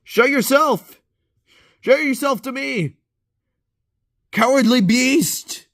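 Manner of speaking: high, loud mock hero challenge; hammy monster-challenging shout. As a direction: call out the beast like a ham hero. Taunting unseen monster.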